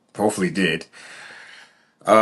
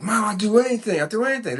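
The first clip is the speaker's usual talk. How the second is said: put-on high kid's promise; high eager boy begging mom; pleading child; 11 semitones higher, faster than usual. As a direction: beg like a six-year-old. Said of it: "So I'm like".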